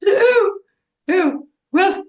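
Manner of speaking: high, loud fluttering mother voice; hammed-up motherly squeals, trailing on; exaggerated fussing mother. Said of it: "she would go on".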